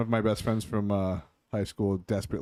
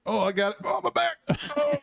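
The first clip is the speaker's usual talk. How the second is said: mock pain. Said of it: "I'd be like".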